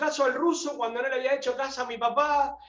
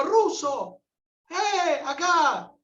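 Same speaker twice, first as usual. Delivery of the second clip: a glad call across the plaza, pitched up; 6 semitones higher, much slower than usual. Retold or imitated. Federico calls out to the Russian in the plaza, glad shout. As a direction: hail a friend you just spotted. Spotting his friend in the plaza.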